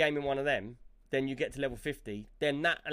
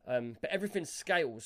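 normal talking voice

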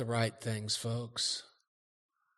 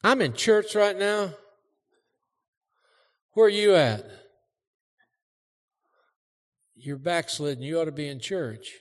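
pretend phone call; 4 semitones higher, 6 dB louder than usual. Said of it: "I just did it for the effect".

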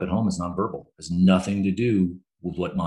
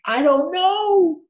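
high, strained cry of frustration, slowing at the start; exasperated angry reply; 5 dB louder than usual. Retold or imitated. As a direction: blurt it out, helpless and mad at once. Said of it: "Why are you so mad?".

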